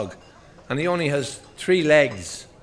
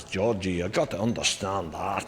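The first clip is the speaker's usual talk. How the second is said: the boss; 5 semitones lower, 5 dB quieter than usual. Acted. Acted boss answering George Best.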